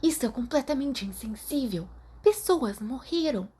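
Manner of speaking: a scandalised friend telling her off; indignant scolding. Acted. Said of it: "sibilou com raiva".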